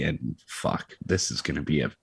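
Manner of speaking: low, soft muttered dread. Real, not acted. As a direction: mutter it like bad news coming. Dread at the topic.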